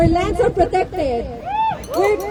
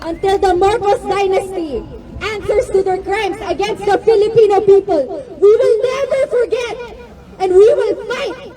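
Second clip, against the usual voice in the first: rising, impassioned protest oratory; rally speech; 3 semitones higher than usual. Real, not acted. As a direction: build to a fiery rally climax.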